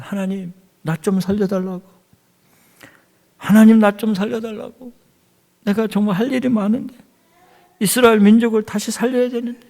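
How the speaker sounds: high-pitched acted plea to God; imitating a king's urgent pleading; desperate prayer. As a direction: beg for your life in prayer. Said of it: "막 그러면서 얼마나 기도합니까".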